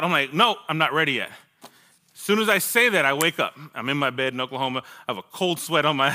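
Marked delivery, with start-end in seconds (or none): none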